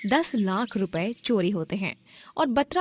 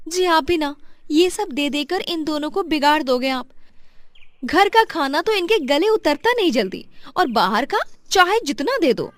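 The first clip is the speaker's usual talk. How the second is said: playful scolding; 7 semitones higher, 6 dB louder than usual. Acted. Wife teasing husband.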